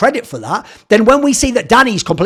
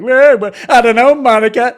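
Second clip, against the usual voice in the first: mock Chandler Bing; 3 semitones higher than usual.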